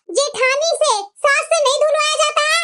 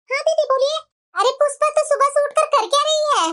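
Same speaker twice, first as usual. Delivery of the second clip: ordinary delivery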